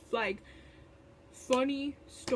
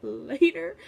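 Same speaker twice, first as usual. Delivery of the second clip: later said louder, with a firm falling finish; louder emphatic later; 3 semitones lower, 8 dB louder than usual. Retold or imitated. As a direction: drive the later home, flat and final.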